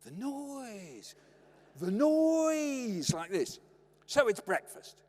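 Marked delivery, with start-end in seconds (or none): 0.0-1.1 high, soft, stretched-out mock suffering; brother's hushed parody of an agonised father; imitating pained dad. Brother walking round the house, imitating pained dad. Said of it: "my brother used to imitate it". 1.7-3.1 high, loud, long drawn-out wail; exaggerated dad imitation. Brother mocking dad's catchphrase, loud exaggerated wail. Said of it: "my brother used to imitate it".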